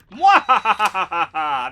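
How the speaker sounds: slow, loud evil cackle; mad scientist laugh. Pretend. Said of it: "The calm zone of entering buttons".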